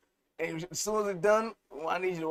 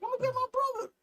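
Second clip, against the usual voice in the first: put-on much higher voice of the girlfriend storming off; girlfriend's threat. The slapped girlfriend leaving, a high mock-female threat. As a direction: squeak it out as the angry girlfriend.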